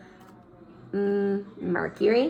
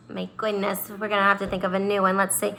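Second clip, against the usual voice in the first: ordinary talk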